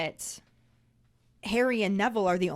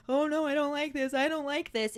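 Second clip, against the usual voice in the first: higher, worried whine; acting out someone starting to panic; hammed-up rising distress; mock alarm; 7 semitones higher than usual. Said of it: "he's going from, like, silly, loopy, goofy to".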